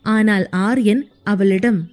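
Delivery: plain reading voice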